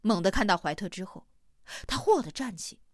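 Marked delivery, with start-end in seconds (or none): none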